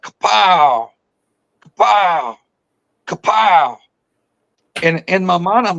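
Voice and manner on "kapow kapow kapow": smack out each kapow like wood cracking; storyteller mimics a tree being struck, mock bangs; imitated tree knocks. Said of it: "it would be like".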